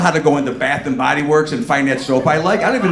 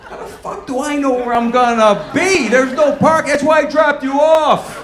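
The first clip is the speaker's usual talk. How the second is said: high, loud exasperated outburst; fed-up shout at the wife; exasperated yell; 9 semitones higher, 4 dB louder than usual. Retold or imitated.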